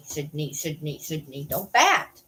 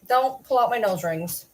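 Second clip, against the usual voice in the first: normal talking voice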